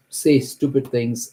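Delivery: ordinary talk, nothing marked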